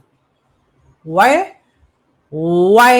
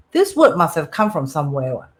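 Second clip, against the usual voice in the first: ordinary talk, nothing marked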